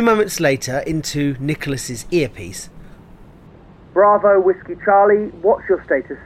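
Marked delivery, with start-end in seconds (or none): 3.9-6.2 crisp British military radio call; British voice over the radio. Acted.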